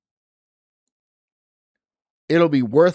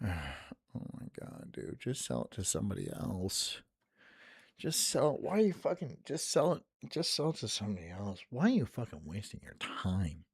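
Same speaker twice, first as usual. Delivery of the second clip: much softer, muttered under the breath; fed-up muttering at a pushy seller; exasperated muttering; 6 semitones lower, 16 dB quieter than usual. Real, not acted. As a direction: grumble it half to yourself.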